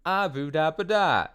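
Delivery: high-pitched mock-French gibberish; girl's garbled French. Quoting the girl in the commercial, high nonsense French. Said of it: "the girl points to herself".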